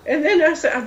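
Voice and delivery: ordinary delivery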